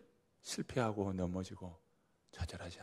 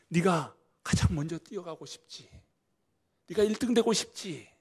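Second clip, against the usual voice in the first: loud, high, urging questions; rousing call; 7 semitones higher, 10 dB louder than usual. Acted. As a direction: lift them up with fire. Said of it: "주님이 아시고 말씀하시는 거예요". (주님이 힘차게 북돋우듯)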